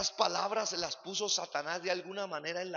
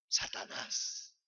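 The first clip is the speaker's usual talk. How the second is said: whisper; 5 dB quieter, much slower than usual. Acted. Naming the devil dramatically.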